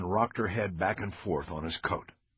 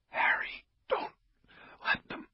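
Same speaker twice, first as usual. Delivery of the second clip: softer, breathy whisper; thin as paper; 5 dB quieter than usual. Acted. Dying officer grips Bosch's arm, thin paper whisper. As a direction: barely get the words out. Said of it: "Her voice was now as thin as paper".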